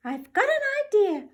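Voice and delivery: officer announcing his plan, squeaky; squeaky mouse. Acted. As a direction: squeak it out with sudden inspiration. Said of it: "squeaked the Big Cheese".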